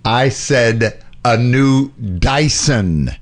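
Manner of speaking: drawn-out ad quote; slow quoted line. Retold or imitated. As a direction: lean on each word slowly. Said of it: "the quote is".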